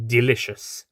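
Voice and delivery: usual speaking voice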